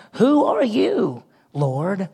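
high, slow, stunned Who are you; drawn-out awestruck question; awed question. Acted. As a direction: ask it slowly, overwhelmed by the light. Paul awed by heavenly voice.

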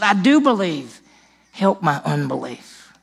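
slow, earnest cry of faith; father crying out to Jesus; pleading confession. Retold or imitated. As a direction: confess belief and doubt at once.

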